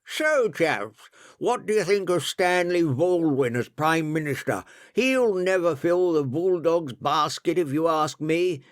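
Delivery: a hint of talking through meat; mumbled, mouth full. A narrator's reading. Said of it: "mumbled Ben with a mouthful of meat".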